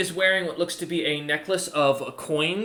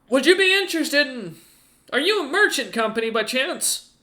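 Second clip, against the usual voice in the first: high, loud ogre voice, wary and polite; ogre's voice; 11 semitones higher, 4 dB louder than usual. Acted. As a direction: speak down from the wall, wary but polite. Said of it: "He nods and goes".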